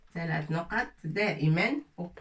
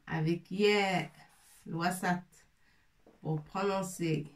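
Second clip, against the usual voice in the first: ordinary talk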